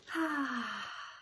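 soft contented sigh; happy sigh. Acted. Froggy settling into his bed, a happy sigh. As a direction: let out a sleepy happy breath. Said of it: "he sighed a happy sigh".

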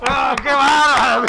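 loud, slow exclaim; mock amazement. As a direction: gush in amazement.

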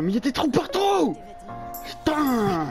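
high-pitched frustrated outburst; raging gamer. Real, not acted. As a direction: rage at the screen. Raging at bad game loadout.

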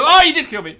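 high, loud indignant cry; laughing-edged shock; surprised shout. Real, not acted. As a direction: exclaim as he gets killed. Caught by enemy.

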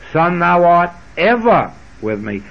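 EVER lifted and punched, "with me" falling away; stress on EVER. Shown as a demonstration. Preacher emphasizing each word of scripture in turn, stress on "ever". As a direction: hit the word "ever" and let "with me" drop. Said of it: "we could emphasize every word".

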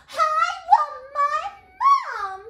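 very high, very loud hoot; long falsetto child's wail; hooting princess. A narrator's reading. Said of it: "hooted the little princess".

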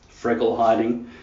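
ordinary talk, nothing marked

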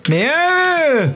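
sharp high exclamation at the stray sheep; exasperated cry. Real, not acted. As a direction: throw it up in sudden disbelief. Frustrated at the sheep in game.

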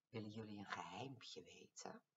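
soft secret whisper. A narrator's reading. Mouse whispering a secret to the dinos.

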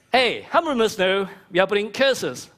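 ordinary talk, nothing marked

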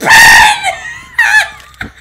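shrieking a name at full volume; screaming a name. Real, not acted. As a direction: scream his name in panic.

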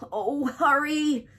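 high, loud, drawn-out plea; anxious whine of urgency; urgent pleading. A narrator's reading. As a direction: beg impatiently. Pig begs rat to hurry.

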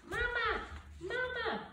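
very high, tiny chirping voice; baby gosling cry. Acted. Goslings following the bear, high and tiny. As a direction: chirp it like a newborn chick.

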